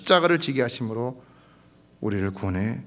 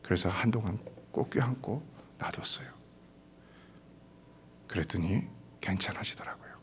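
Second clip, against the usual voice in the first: soft unvoiced ending; hushed whisper; 2 semitones lower, 11 dB quieter than usual. Real, not acted. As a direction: let it fade to a whisper. Softly closing the anecdote. (속삭이듯 조용히)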